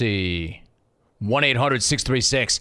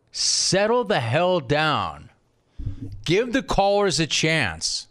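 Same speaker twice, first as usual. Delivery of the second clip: drawn-out scolding; 3 semitones higher, slower than usual. Real, not acted. Slow scolding.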